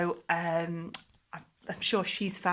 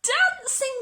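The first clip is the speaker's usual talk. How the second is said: much higher, louder delighted shout; joyful cry; 8 dB louder, slower than usual. Acted. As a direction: burst out with pure joy. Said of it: "Gerald cried".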